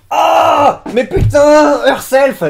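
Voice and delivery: loud, high exclamation of exasperated surprise; baffled outburst. Real, not acted. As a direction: cry out as confusion hits. Baffled outburst at word just read. (exclamation stupéfaite et exaspérée)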